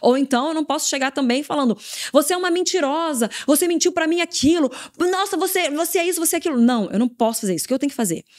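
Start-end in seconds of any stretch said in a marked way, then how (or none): 2.1-6.6 higher-pitched mock accusation; accusing. Leader wrongly confronting a disciple who lied, accusing. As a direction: pile up the accusations in a raised pitch. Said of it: "eu tô acusando a pessoa".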